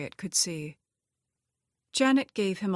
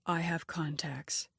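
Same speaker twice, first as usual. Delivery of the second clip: husky whisper; 5 dB quieter than usual. A narrator's reading. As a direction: breathe it like a secret. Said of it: "her voice husky".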